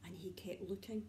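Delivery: whispered narration. A narrator's reading. Storyteller hushing the children while owl keeps looking. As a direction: lean in and whisper it.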